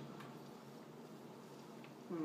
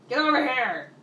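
loud, high yell; shouting; 5 semitones higher, 14 dB louder than usual. Real, not acted. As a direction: bellow at the fleeing enemy. Chasing enemy in game.

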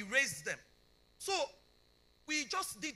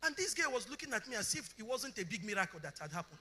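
ordinary talk

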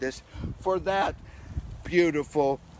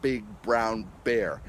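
slow, careful recitation; deliberately slow read; slow tongue twister; 4 semitones lower, slower than usual. Shown as a demonstration. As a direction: say every b slowly. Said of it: "One of the most famous ones is".

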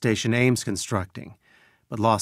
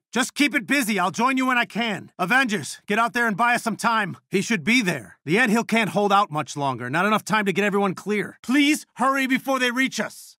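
high-pitched, urgent rallying; 7 semitones higher than usual. Acted. Calling Avengers to defend the anthill, urgent. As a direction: push them to hurry, pitch raised. Directs Avengers under attack.

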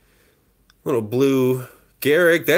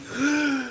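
gasping exhale; 9 semitones higher, 7 dB quieter than usual. Real, not acted. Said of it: "That was all in one breath".

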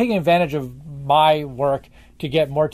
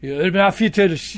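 imitating a German fan's thick German accent. Retelling a German fan's question in a thick German accent. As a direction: quote him in a heavy German accent. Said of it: "in very thick German accent".

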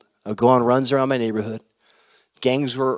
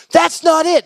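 high, loud elated exclamation; excited; 7 dB louder than usual. Acted. Anderson tells his friend there is more healing, highly excited. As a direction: burst out with more news, pitched way up.

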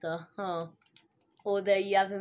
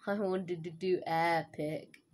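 usual speaking voice

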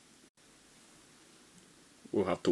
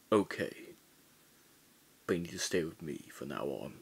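ordinary talk, nothing marked

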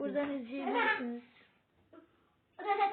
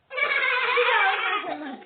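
laughing shout; 6 semitones higher, 11 dB louder than usual. Real, not acted. Chaos while filming.

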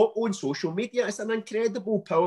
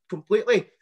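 mock emphatic retort; 5 semitones higher, 6 dB louder than usual. Scolding the sender, repeats completely.

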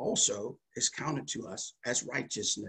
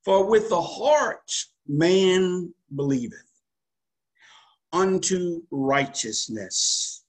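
solemn scripture reading; 3 semitones higher, 4 dB louder, slower than usual. Acted.

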